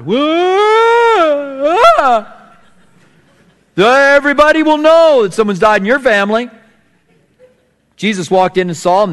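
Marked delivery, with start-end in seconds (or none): 0.0-2.3 high, loud hammed-up wailing cry; mock wail. Preacher imitating hired mourners' fake wailing cry. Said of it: "professional mourners". 3.8-6.5 high, loud hammed-up wailing mourner; professional mourner pitch. Preacher mocking hired mourners with a loud wail. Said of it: "They had professional mourners".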